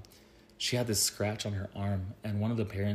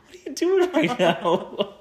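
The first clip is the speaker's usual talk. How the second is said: high, loud, slow, laughing; laughing disbelief; 9 dB louder, slower than usual. Real, not acted. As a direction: ask it while cracking up.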